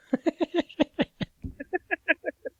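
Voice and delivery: high, long burst of real laughter; big laugh. Real, not acted. Host bursting out laughing at the angry caller's voicemail. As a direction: crack up at the voicemail.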